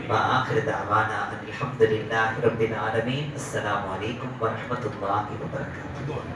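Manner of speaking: low Arabic sign-off; formal closing invocation. Real, not acted. Preacher closing the sermon with salaam, low recitation.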